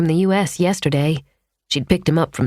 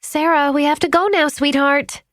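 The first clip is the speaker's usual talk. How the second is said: coaxing gently; 11 semitones higher than usual. A narrator's reading. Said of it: "tried to hurry the little girl along without upsetting her".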